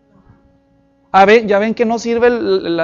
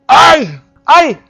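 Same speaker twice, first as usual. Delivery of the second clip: pained yelp; 5 semitones higher, 6 dB louder than usual. Retold or imitated. Blind man feels mud on eyes.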